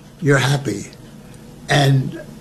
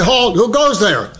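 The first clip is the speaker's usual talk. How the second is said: higher, formal mock challenge; proper guard challenge; 6 semitones higher than usual. Sergeant corrects the private's challenge, formal higher voice. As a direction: say it by the book. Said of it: "you're supposed to say".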